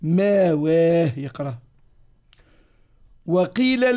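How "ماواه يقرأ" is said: ماواه said slowly with a long plain vowel and no hamza; slow ماواه, with the hamza replaced by a long a; hamza dropped. Shown as a demonstration. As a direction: draw out ماواه without any glottal stop. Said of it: "وسوسي يبدل".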